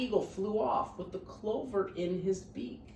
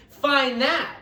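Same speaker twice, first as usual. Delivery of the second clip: louder taunting sneer; 5 semitones higher, 14 dB louder than usual. Acted. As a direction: throw the dare at him scornfully. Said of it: "sneered the bird".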